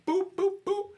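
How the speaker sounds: three loud, high "boop" punches; imitated punch sounds. Storyteller punching the dude dead in his face, imitated punch sounds. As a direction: punctuate each hit with a high, loud boop.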